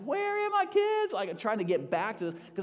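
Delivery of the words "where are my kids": high, drawn-out holler; calling out. Retold or imitated. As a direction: call across the woods for the kids. Hollering back for his kids.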